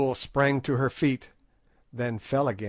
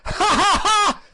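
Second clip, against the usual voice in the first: very high, loud cackling laugh; old hag laughing wildly on the ground; ghastly merriment; 9 dB louder than usual. A narrator's reading. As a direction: cackle madly, writhing with glee. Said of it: "writhed in ghastly merriment".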